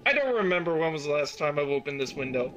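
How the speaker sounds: ordinary delivery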